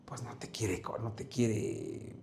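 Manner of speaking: unvoiced whisper, slowing down. Shown as a demonstration. Preacher whispering to show a hidden voice. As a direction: breathe it so no one hears.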